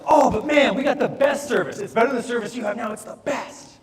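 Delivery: high, loud, beaming sales pitch; overly enthusiastic salesman. Retold or imitated. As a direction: pump every word full of eager cheer. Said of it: "He's so enthusiastic".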